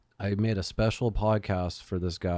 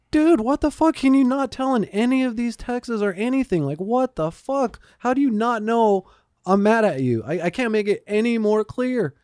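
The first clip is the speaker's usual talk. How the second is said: much higher and louder, a hammed-up rant at the friend; retold furious yell; 13 semitones higher, 5 dB louder than usual. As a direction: imitate yourself blowing up, words slowed on 'what the fuck'.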